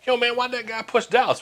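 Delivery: high-pitched mock incredulous question; retold bewilderment. Barber asking about the fight outside his shop, acted high and baffled.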